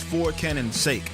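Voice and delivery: usual speaking voice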